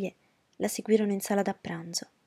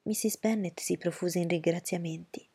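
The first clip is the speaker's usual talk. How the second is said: ordinary delivery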